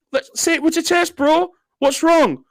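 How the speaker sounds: high, mocking taunt. Real, not acted. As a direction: goad him to speak up. Taunting the accused on stream.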